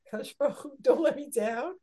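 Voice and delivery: loud, high imitated plea to God; imploring. Voicing a mother's plea to God.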